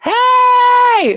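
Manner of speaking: high, loud shouted call; cookies hollering. Retold or imitated. Subway cookies calling out to a passing trucker, loud high holler. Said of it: "they'll start hollering".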